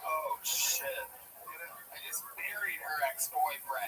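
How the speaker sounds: hushed aside. Acted. Punchline whispered in character.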